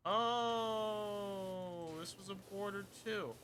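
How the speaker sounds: long, high drawn-out oh; real surprised discovery; sudden realisation. Real, not acted. Suddenly notices a missed border.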